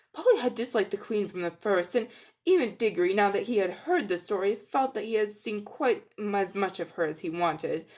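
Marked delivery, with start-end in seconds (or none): none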